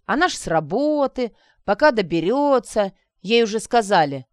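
ordinary delivery, nothing marked

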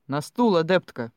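loud, sharp order; exasperated master's loud command; stern command. A narrator's reading. Magister orders student onto chair.

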